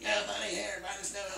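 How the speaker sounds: high-pitched put-on impersonation; Hartman. Bandmate imitating a friend pushing merch, high put-on voice. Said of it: "It's an excellent Hartman impersonation".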